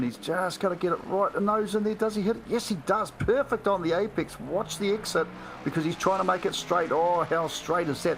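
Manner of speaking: high-pitched, rising motorsport call; excited play-by-play. Real, not acted. Co-commentator watching the driver hit the apex, excited. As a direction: urge the car through, then admire it. Calls a tricky corner.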